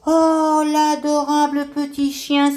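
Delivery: excited exclamation; delighted cry. Acted. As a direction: coo out loud in delight. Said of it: "s'écrie Grande Feuille".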